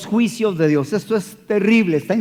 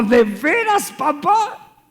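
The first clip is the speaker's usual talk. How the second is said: put-on high, delighted child's voice; excited child; 10 semitones higher, 4 dB louder, much slower than usual. Young son thrilled his father will pay him. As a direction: squeal it like a thrilled little boy. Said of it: "Y me dijo".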